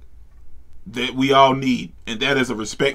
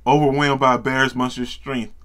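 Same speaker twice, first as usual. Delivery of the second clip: usual speaking voice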